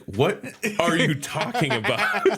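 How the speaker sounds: high, drawn-out mock disbelief; incredulous. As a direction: act out a baffled wife, half laughing. Wife baffled by husband's odd talk.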